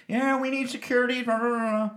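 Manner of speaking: high-pitched mock cashier on the phone; high, dismissive imitation; mimicked cashier. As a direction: parody a store call, trailing into blahs. Mimics cashier phoning for security.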